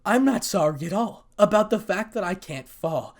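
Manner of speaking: a touch of cold, dark threat; dark menace. A narrator's reading. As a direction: say it darkly, hinting at violence. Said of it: "said Issei darkly".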